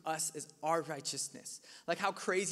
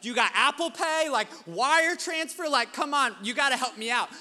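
higher, louder, begging about payment apps; exasperated pleading; 7 semitones higher, 10 dB louder than usual. Retold or imitated. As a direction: plead for any other way to pay. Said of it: "I was like".

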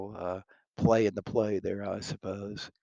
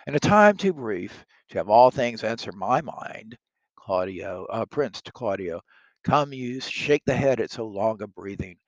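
reading Shakespeare aloud, louder and livelier than the commentary; dramatic play reading; 2 semitones higher, 8 dB louder than usual. Acted. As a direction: perform the verse for the class.

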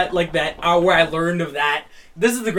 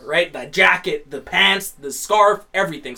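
ordinary talk, nothing marked

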